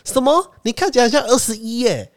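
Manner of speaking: high, exaggerated astonishment; hammed-up flattering surprise; fake surprise. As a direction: overplay the salesman's shock. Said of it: "他说".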